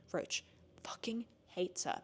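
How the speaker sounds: slow conspiratorial whisper; slow, hushed gossip whisper; whispered aside. Real, not acted. Gossiping about the characters' hatred.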